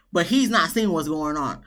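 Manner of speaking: ordinary delivery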